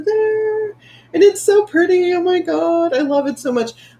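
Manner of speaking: high, bright-eyed gush over the new tattoo; excited. Real, not acted. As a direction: squeal it out, thrilled.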